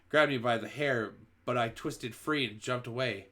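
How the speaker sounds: plain reading voice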